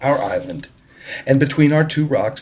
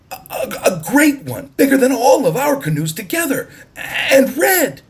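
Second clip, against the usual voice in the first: climbing higher and louder with each phrase; a small boy overwhelmed, shouting; excited cry; 4 dB louder than usual. Acted. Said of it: "he cried".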